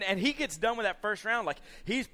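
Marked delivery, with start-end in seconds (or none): none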